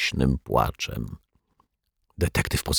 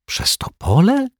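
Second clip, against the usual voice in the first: Przez Topole whispered high, rising in disbelief; stunned whisper. Acted. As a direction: gasp out Przez Topole in astonishment. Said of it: "osłupiała Asia".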